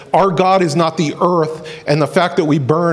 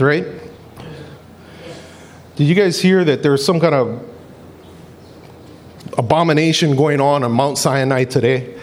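usual speaking voice

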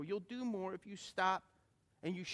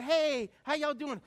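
louder, drawn-out welcoming hello; cheery greeting; 8 semitones higher, 8 dB louder, slower than usual. Retold or imitated. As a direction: greet the crowd big and slow. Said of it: "'cause you go".

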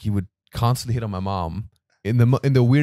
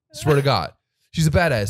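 ordinary delivery

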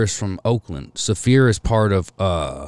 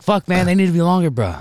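excited quote; 10 semitones higher, 3 dB louder than usual. Retold or imitated. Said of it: "I was like".

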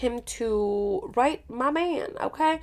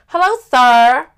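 loud mock greeting; 3 semitones higher, 11 dB louder than usual. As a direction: greet him loudly down the line. Acting out her phone greeting.

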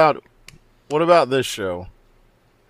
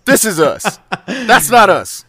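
loud, higher, laughing protest over watching TV; laughing mock rant; 8 semitones higher, 8 dB louder than usual. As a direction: shout it like a fake argument, cracking up as you go. Acted quarrel about watching TV.

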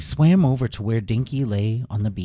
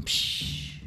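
ordinary delivery

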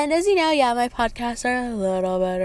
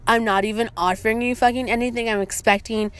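normal talking voice

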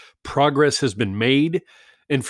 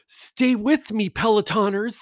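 high, slow, syrupy mock instructor; sing-song parody of a fitness coach; 8 semitones higher than usual. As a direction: ham up the cheesy trainer's encouragement. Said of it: "When I do this voice, it means I'm mocking them".

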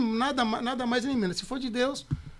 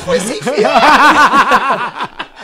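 high, loud mock outcry; acted-out disbelief; 6 semitones higher, 18 dB louder, much slower than usual. Wife reacting to news of the job, hammed-up high shriek. Acting out shocked phone reaction.

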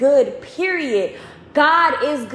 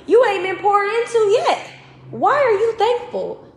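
high-pitched needling challenge; incredulous enemy; 7 semitones higher than usual. Retold or imitated. The enemy challenges a weary believer, incredulous. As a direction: question them in a high, disbelieving voice.

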